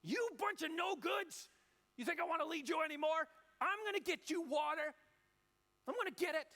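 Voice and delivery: high, loud furious yell at the rebels; angry shout. Acted. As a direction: bellow at them in rage. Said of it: "Here's his tone, his voice".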